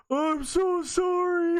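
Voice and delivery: high, very drawn-out mimicry; mocking friends' voices. Friends laughing at him across the park, high slow mocking imitation. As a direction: tease with an exaggerated, stretched-out whiny apology. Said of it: "They're all fucking making voices and shit".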